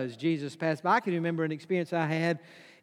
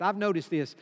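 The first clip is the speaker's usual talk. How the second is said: normal talking voice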